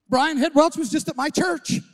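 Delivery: high and loud, bursting with news; excited announcement. Retold or imitated. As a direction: blurt it out thrilled. Excitedly telling the neighbour.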